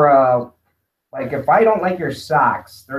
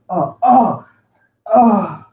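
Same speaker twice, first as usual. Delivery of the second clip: raised, hammed-up moans of delight; mock ecstatic reading; 4 semitones higher, 4 dB louder, much slower than usual. Showing how they read beloved books, mock ecstatic moans. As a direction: moan as if the book thrills you. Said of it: "This is how I read it".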